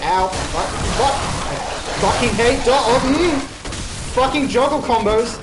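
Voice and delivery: loud, high frustrated cursing; angry yell. Real, not acted. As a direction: curse the enemy out. Frustrated by enemy combos.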